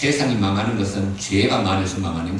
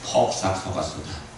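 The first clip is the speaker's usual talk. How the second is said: Jeju dialect; 4 semitones lower, 6 dB quieter than usual. Shown as a demonstration. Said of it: "제주도 말은 뭐라 그러죠?".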